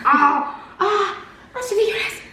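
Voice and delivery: dégoût aigu. Real, not acted. Disgusted at candy.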